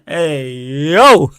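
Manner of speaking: long drawn-out, loud rising exclamation; scandalised. Real, not acted. Streamer reacting to his own innuendo, scandalised.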